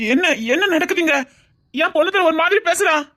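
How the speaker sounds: confused outcry at what is happening; bewildered. Acted. Bewildered cry to everyone about what is going on.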